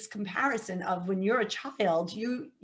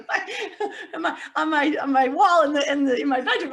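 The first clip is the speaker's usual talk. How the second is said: laughing; 6 semitones higher, 6 dB louder than usual. Real, not acted. Laughs about crucifix on wall.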